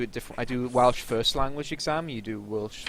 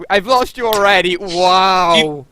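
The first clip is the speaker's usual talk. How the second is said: exasperated outburst; 3 semitones higher, 11 dB louder, slower than usual. Real, not acted. Friend mangles Welsh phrase.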